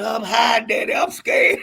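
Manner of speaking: high, loud, laughing fright; laughing frightened kid; mock scared child. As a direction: cry out like a scared little boy. Child hiding in the seats.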